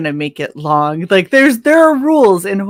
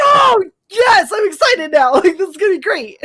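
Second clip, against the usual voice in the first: excited shout; 12 semitones higher than usual. Retold or imitated. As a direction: squeal it out with delight. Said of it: "And I started looking at it, like".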